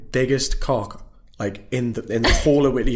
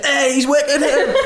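very high, slow cheering with a laugh; mocking classmates; 7 dB louder than usual. Classmates cheering [name]'s Wonderwall, high laughing mock cheer. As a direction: hype him up in a squeaky laughing cheer. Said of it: "Everyone was like".

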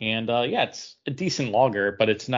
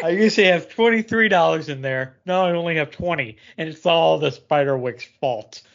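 mock upset; 4 semitones higher, 4 dB louder than usual. Joking about lost money.

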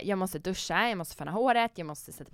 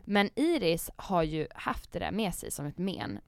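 usual speaking voice

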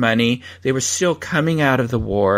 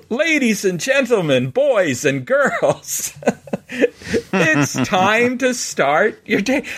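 grand, drawn-out theme park announcement, higher and slow; imitating announcer voice; 7 semitones higher, slower than usual. Husband greets the morning in his announcer voice, slow and grand. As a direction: stretch each word like a park PA announcer. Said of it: "as if their announcing voices are the way they speak every day".

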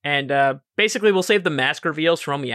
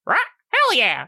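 squawking bird; 10 semitones higher than usual. Acted. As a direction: shriek it like a pet bird.

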